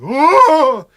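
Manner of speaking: sudden high yelp of pain; hammed-up injured cry; mock pained yelp. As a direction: yelp like a player going down. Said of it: "and he was".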